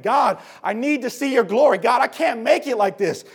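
desperate prayer rising to a yell; begging God. Retold or imitated. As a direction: build the cry from plea to shout. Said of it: "He's begging God".

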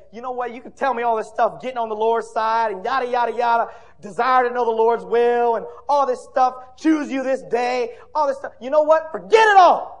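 loud, rising mock rant of a fed-up listener; dismissive outburst. Listener turning away from the conference, loud dismissive outburst. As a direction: build from scoffing to a high shout.

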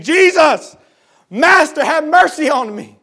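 loud, high acted plea; pleading shout, pitched high; crying out. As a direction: cry out for mercy across a distance. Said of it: "they cried out to Jesus saying".

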